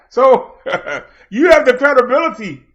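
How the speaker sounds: laughing through the insult; laughing jab. Real, not acted. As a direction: chuckle while you mock him. Mocking rival with a laugh.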